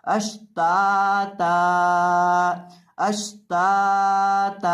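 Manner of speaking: अश्ताता read slowly with the ता drawn out at the stop; pausal form. Shown as a demonstration. Teacher showing how to stop on the word. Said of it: "अलिफ के बराबर ता को लंबा करेंगे".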